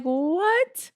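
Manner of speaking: high, drawn-out surprised what. Retold or imitated. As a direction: squeak it out in disbelief. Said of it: "And I'm like".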